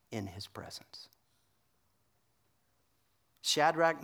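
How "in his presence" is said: hushed whisper. Real, not acted. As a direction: whisper it, reverent and close. Preacher drops voice on key phrase.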